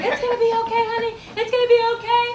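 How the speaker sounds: high, loud sing-song soothing. Acted. Teacher talks over kicking and screaming, loud high reassurance.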